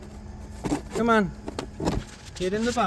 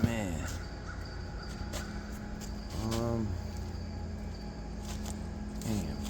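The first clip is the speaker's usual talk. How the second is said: muttering; 8 semitones lower, 11 dB quieter than usual. Real, not acted. Quiet muttering to self.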